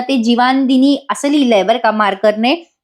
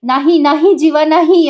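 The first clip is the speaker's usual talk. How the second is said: higher, urgent cry of refusal; a contained, acted scream at raised pitch; mild mock scream; 5 semitones higher than usual. Nandini wakes from her dream, mild mock scream. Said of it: "ती मोठ्याने किंचाळते".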